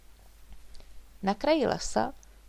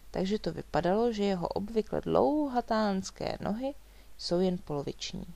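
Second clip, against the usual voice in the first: ordinary delivery, nothing marked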